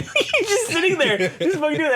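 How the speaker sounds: high, breaking into laughter; laughing while talking. Real, not acted. Friend laughing mid-story, giggling and pitched high. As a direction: tell it through a fit of giggles.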